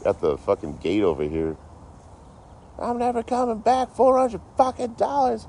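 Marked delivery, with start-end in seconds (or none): 2.8-5.5 mock yelling lady. Mimicking a lady yelling at the gate about money, high mock yell. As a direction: imitate her yelling in a high shrill. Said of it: "She's just yelling".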